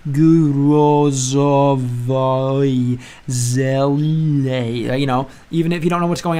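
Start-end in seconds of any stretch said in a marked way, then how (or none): 0.1-4.9 "Gurozovoi zelei" drawn out very slowly, one sound at a time. Shown as a demonstration. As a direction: sound out the Russian like a beginner reading letter by letter. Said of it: "you can at least go".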